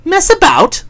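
high puzzled echo; incredulous. Acted. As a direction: echo it in disbelief. Bertie echoing incredulously.

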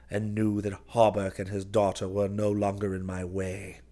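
usual speaking voice

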